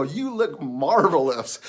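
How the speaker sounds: loud, slow, drawn-out praise; lavish the words on him, stretching them; hammed-up compliment. Teller admiring a stranger's new jacket, hammed-up compliment.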